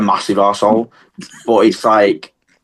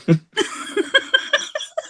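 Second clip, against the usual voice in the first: breathless high wheeze of laughter; wheezing silent laugh; 6 dB quieter than usual. Real, not acted. Cracking up after joking she needs an exorcism, wheezing laugh.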